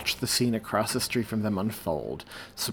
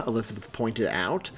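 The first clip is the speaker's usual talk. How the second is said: ordinary delivery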